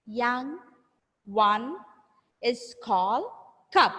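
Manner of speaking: isolated words with long pauses; word-by-word repeat-after-me. Shown as a demonstration. Model sentence split for pupils.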